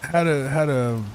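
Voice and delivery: stuttering on the words; stumbling, repeating words. Real, not acted. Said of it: "I can stop stuttering".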